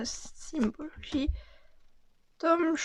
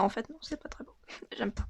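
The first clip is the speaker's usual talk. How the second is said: soft let-down whisper; whispered let-down over a card; 7 dB quieter, much faster than usual. Real, not acted. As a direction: murmur your disappointment with a laugh. Disappointed by a card.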